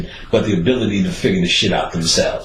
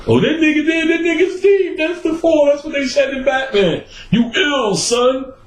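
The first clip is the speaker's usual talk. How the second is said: mock impressed fan. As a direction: ham up a shallow fan gushing. Mocking shallow occultist's praise.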